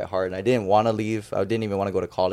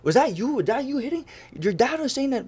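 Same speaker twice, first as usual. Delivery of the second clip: much higher, eager motherly questions; excited mom; 14 semitones higher than usual. Retold or imitated. Mother asking her son if that was his hit, high and excited. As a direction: play his mom, surprised and thrilled.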